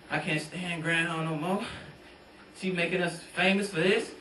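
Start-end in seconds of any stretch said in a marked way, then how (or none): none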